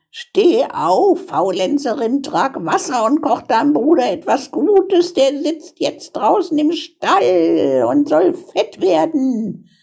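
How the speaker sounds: high-pitched, bossy witch; witch calling out. A narrator's reading. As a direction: order the girl about in a high witch voice. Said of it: "rüttelte sie wach und rief".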